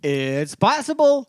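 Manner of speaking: high, slow teasing drawl; slow teasing sing-song. Pretend. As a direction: stretch it out slowly, pitched high. Teasing repeated claim.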